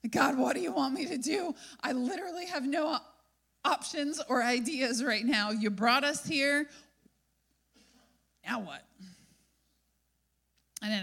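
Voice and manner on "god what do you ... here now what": freaked out. Retold or imitated. Moses turns away and frets to God, hammed-up panic. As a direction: let the calm crack into panic. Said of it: "turned around and freaked out".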